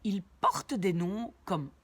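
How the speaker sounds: ordinary talk, nothing marked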